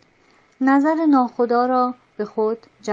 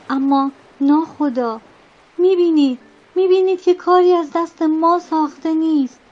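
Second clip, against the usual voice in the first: louder, higher desperate protest; urgent interruption; 3 semitones higher, 4 dB louder than usual. Acted. Boatswain cutting off the captain, desperate and loud. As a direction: cut in and insist it's hopeless. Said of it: "حرف ناخدا را قطع کرد".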